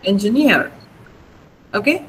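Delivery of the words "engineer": "Engineer" with NEER long and stressed, falling; stress on last syllable. Shown as a demonstration. Teacher correcting a student's "Engineer", final NEER stressed. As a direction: hit NEER at the end of "Engineer". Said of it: "You have to pronounce it".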